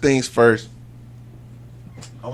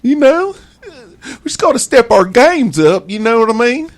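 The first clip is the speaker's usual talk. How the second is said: Florida cracker voice; 11 semitones higher, 4 dB louder than usual. Pretend. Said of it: "it's my Florida cracker voice".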